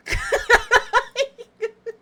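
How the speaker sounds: loud, high-pitched cackle; real helpless high laughter; laughing. Real, not acted. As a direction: burst out in a high staccato laugh. Laughing at their shared habit.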